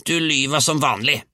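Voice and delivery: put-on high, accusing old-woman yell; grandmother's howl. A narrator's reading. Grandmother calls grandson a liar, high yell. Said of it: "hylte bestemor".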